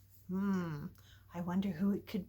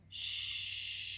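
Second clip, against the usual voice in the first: soft shush; 7 dB quieter than usual. Real, not acted. Reader calming things at the story's end, shushing. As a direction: hush them down. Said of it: "hushing at the end".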